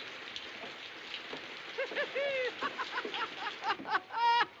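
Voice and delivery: a long, high burst of laughter. Real, not acted. Laughing along with the stranded passengers. Group laughing in plane cabin.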